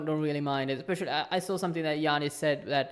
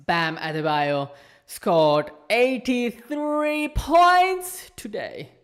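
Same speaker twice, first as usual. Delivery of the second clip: slow, rising, louder hype; hyped announcement; 5 semitones higher, 5 dB louder, much slower than usual. Real, not acted. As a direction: stretch it out, amazed. Announcing huge score.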